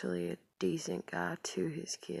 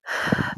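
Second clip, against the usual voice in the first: short, forceful exhale; quick heavy huff of breath; loud sigh; 12 dB louder than usual. Real, not acted. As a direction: let out a loud, sharp sigh. Sighs between remarks.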